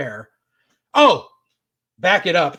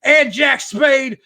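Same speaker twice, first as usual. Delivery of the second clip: higher, slowed, shouted challenge; mock promo shout; 8 semitones higher, 4 dB louder, slower than usual. Veteran challenging Ace on the mic, hammed-up shout. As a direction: bellow the challenge like a ring announcement. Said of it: "And he's like".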